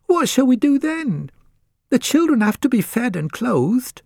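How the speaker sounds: high, anxious wife's voice; a mother fretting over hungry children; worried wife. Acted. Mother asks husband how to feed children, high worried voice.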